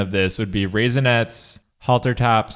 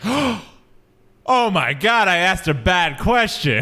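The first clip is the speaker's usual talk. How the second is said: high, drawn-out mock dismay; slow gasped-out horror, hammed-up; horrified realization; 5 semitones higher, much slower than usual. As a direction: play a kid realizing the awful mistake. Child's horror at mom's answer.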